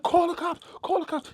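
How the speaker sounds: very high, frantic shriek; screaming for help. Retold or imitated. Robbed woman screaming so the guard finally reacts. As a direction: scream it over and over to get through. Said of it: "when he heard me scream".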